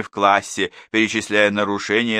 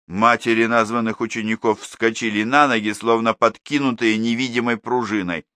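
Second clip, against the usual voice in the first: ordinary delivery, nothing marked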